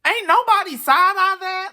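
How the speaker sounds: loud, very high incredulous squeal; high-pitched disbelief. Real, not acted. Host can't believe nobody was suspicious, high-pitched disbelief. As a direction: push it high and loud, aghast.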